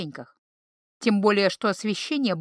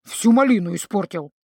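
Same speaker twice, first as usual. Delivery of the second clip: louder disappointed troll grumble; grumbling indignation; 5 dB louder than usual. A narrator's reading. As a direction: sulk that the fun is ruined. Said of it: "возмутился ... Грым". (обиженно ворча)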